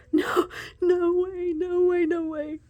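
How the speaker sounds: overwhelmed with delight; squealing disbelief. Real, not acted. Overwhelmed by a card.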